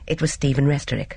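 usual speaking voice

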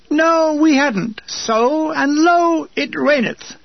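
high, slow, drawn-out intoning; mock-biblical proclamation. A narrator's reading. Mock-biblical answer to own question.

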